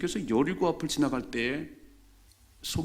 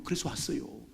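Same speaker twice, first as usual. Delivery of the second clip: ordinary delivery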